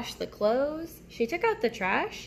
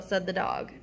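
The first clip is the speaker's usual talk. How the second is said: usual speaking voice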